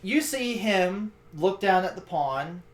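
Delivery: ordinary delivery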